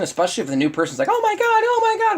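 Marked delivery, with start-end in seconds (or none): 1.1-2.2 very high-pitched gushing squeal; hammed-up, high excited fawning; excited new person. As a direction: squeal like a guest thrilled by a dog. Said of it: "the excitement of a new person".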